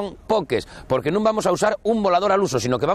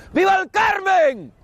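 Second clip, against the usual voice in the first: festive shout. Real, not acted. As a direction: yell it out to the crowd. Festival cheer after firing rocket.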